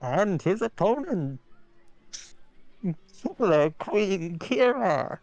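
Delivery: slow, stretched fighter introduction; 1950s announcer. Acted. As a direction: drag out the name like a ringside host. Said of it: "Can you do a nineteen fifties announcer?".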